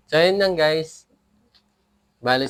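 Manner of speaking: ordinary delivery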